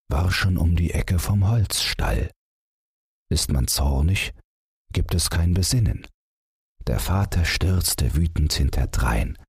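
plain reading voice

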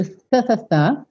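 repeated th with tongue between teeth; tongue on front teeth, breathe th; th sound. Shown as a demonstration. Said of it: "que é a linguinha lá nos dentes da frente".

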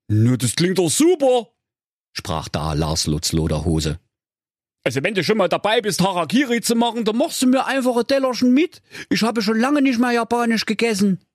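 high, fast cheeky voice; cheeky hero voice. Acted. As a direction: rattle it off cheekily. Lars speaks cheekily.

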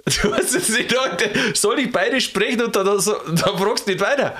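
high, laughing incredulous questions; laughing disbelief. Real, not acted. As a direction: tease him in amused disbelief. Empört-lachend über fehlende Nachfrage. (hohes, lachendes Ungläubigsein)

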